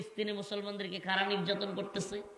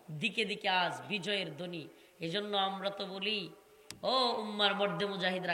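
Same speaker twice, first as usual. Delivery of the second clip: usual speaking voice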